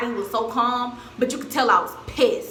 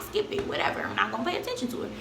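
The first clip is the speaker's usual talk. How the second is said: softer, quick whispered brush-off; muttered aside; 6 dB quieter, faster than usual. Retold or imitated. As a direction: say it under your breath to yourself. Inner thought, ignoring the commotion.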